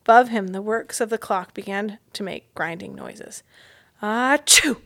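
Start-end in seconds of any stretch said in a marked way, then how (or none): 4.0-4.8 loud burst of a sneeze; acted sneeze. Mouse sneezing under the clock, loud burst. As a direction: let the sneeze explode. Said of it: "The sneeze could not be held back".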